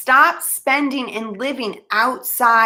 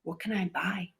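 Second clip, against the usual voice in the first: hushed mock awe; 3 semitones lower, 15 dB quieter than usual. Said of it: "you're gonna be like".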